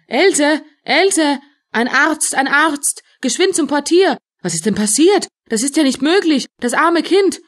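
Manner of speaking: high, urgent calls for help; alarmed outcry. Acted. Bystanders call a doctor for Else, urgent alarm. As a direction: cry out in rising alarm.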